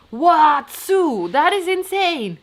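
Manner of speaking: high, loud astonished exclaim. Real, not acted. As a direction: blown away by the viewer's news. Reacting to a viewer's plant age.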